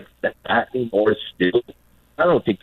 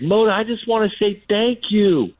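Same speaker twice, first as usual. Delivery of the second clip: lightly voiced fan, pitched much higher; mock fan voice; 11 semitones higher than usual. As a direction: put on a higher, eager fan voice. Imitating fan thanking Mota.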